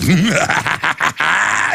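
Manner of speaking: rising evil cackle; hammed-up evil laughter; mock villain laugh. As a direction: cackle like a gloating tyrant. Villain's laugh after quoted threat.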